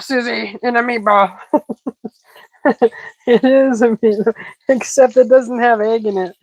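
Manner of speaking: usual speaking voice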